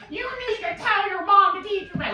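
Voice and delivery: high, squeaky put-on kid voice; mocking his voice. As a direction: make fun of how the kid sounded. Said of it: "laughing at this dude's voice going".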